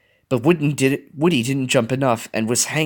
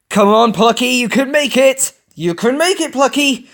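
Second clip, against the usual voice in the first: cheering; 12 semitones higher, 5 dB louder than usual. A narrator's reading. Friends cheering runner on.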